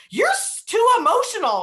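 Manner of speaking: imitating mom telling her she's too emotional; a mocking high mom voice; mom's exasperated retort. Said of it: "she was like".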